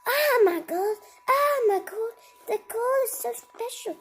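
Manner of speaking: high, loud squeal over the gold; squealing giant delight. Acted. Giant gloating over his gold, squealing high. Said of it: "said the giant".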